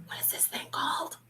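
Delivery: hushed secret question; whisper. Acted. Teacher whispers quiz question.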